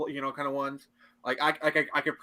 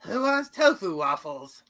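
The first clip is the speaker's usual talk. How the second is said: higher, drawn-out put-on voice; cheery character call; 4 semitones higher, 4 dB louder, slower than usual. Offering tofu waffles to everyone, slow high cheery voice. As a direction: sing out the offer slowly. Second try at acted waffles line.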